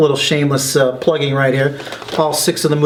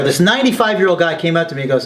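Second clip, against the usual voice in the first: usual speaking voice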